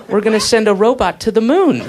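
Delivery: cheerful announcement through laughter; laughing. Retold or imitated. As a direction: say it laughing, upbeat. Voicing government, laughing.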